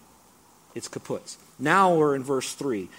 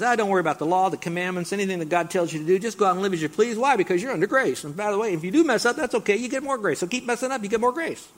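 higher, louder sly tempter's voice; playing Satan as a smooth, higher tempter; tempting Satan; 6 semitones higher, 3 dB louder, faster than usual. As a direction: coax them like a sly devil. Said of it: "he then says".

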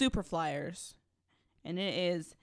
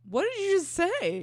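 real high-pitched, loud bafflement; loud incredulity; 13 semitones higher, 8 dB louder than usual. Real, not acted. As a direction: burst out, unable to believe your ears. Baffled by the garbled impression.

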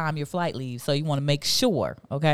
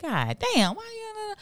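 mock inner grumble; 3 dB quieter, slower than usual. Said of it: "in my head though".

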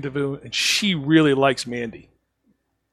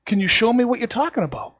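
raised, puzzled asking; higher confused boss voice; baffled; 8 semitones higher than usual. Retold or imitated. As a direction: sound genuinely lost and confused. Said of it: "I'm like".

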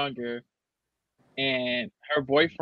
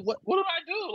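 put-on high, frightened little-kid voice, much higher; reliving a child's fear, pitched way up; scared child; 14 semitones higher, 4 dB quieter than usual. Scared nephew woken by adults arguing, high and frightened. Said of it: "like, scared".

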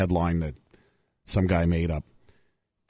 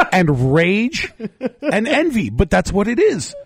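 high, laughing outburst; 10 semitones higher than usual. Real, not acted. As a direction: laugh through it, fired up. Laughing, impassioned.

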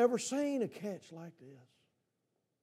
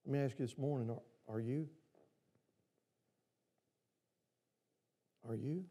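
hushed question; 8 semitones lower, 12 dB quieter than usual. Real, not acted. Preacher quietly asking the congregation directly, hushed. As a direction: drop to a low, soft, searching question.

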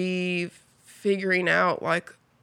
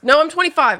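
higher and louder, catching herself; oh wait, correcting her age; sudden realisation; 9 semitones higher, 8 dB louder than usual. Real, not acted. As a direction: blurt out the correction.

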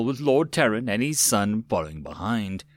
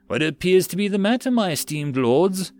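high, honeyed lady's voice; sweet voice; 7 semitones higher than usual. A narrator's reading. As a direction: address them with polished sweetness, slowing at the end. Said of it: "a sweet voice came over".

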